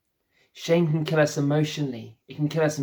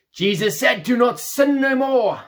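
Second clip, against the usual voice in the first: higher, louder mock finger-wagging zealot; mock preachy zealot; 8 semitones higher, 6 dB louder than usual. Pastor mocking judgmental Christians, high and loud mock preachiness. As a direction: lampoon the Bible-bashers, pitched up and loud. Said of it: "those ones there who are really, ooh, bash it".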